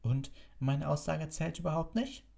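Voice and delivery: high-pitched, offended challenge; rasping. A narrator's reading. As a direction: demand recognition, piqued. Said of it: "schnarrte Snape". (gekränkt schnarrend, hoch)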